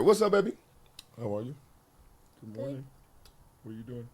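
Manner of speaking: gentle check-in with the little one. Real, not acted. Talking to his child on phone.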